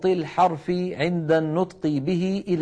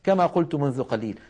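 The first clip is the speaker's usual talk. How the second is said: ordinary talk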